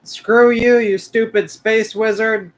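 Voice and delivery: loud trash-talk at the screen; gamer yelling. Real, not acted. Yelling at an enemy in the game, loud and taunting.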